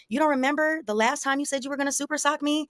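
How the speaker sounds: quick, high-pitched needling reminder; retold taunt.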